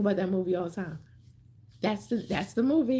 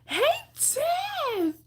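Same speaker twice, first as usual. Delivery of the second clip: much higher, sing-song hello to a friend; excited greeting. Real, not acted. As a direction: call out her name with delight. Greeting a viewer who joined.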